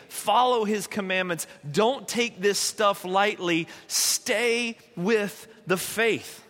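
slow, drawn-out plea with pauses between words; earnest pleading. Acted. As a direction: implore them, stretching the key words. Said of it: "He's pleading with them".

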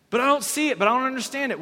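protesting Nicodemus. Retold or imitated. Nicodemus objects, confused.

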